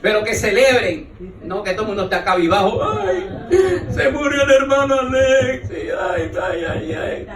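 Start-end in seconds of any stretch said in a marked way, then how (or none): 2.8-7.3 mock wailing mourner. Mocking mourners at a funeral.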